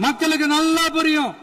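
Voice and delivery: high-pitched, insistent political speech. Real, not acted. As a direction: pitch it high so opponents hear. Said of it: "நான் ஏன் கொஞ்சம் சவுண்டா பேசுறேன்".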